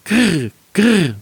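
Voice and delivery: imitated raven croak; Rabenkrächzen. A narrator's reading. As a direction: growl out the raven's rolled croak. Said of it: "so daß er quakte". (nachgemachtes Krächzen des Raben)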